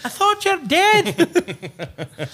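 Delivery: very high, loud, drawn-out wail; hammed-up accented shriek through laughter; mock shocked cry. Repeats the acted catchphrase.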